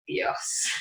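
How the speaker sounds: breathless whispered gasp of dismay; whispered "Dios". Retold or imitated. Overwhelmed newcomer at dubbing booth, whispering to herself. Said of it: "Y yo".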